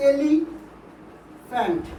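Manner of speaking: "Elephant" very slowly, long pause before ফ্যান্ট; split into two syllables. Shown as a demonstration. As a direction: break "elephant" in two: এলি, then ফ্যান্ট. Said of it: "দুবারে উচ্চারণ করতে হচ্ছে".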